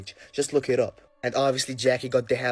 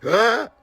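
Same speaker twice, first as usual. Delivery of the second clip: short mock scream, high and loud; sudden rising "Ah!" imitating a scream; imitated scream; 8 semitones higher, 7 dB louder than usual. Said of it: "he started screaming like".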